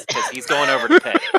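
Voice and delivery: high excited squeal. Real, not acted. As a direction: rush it out, delighted. Laughing about Gears petting wolf.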